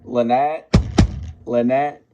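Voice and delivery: mock desperate calling of Lynette. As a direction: call her from inside the coffin, rising each time. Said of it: "you could hear him going".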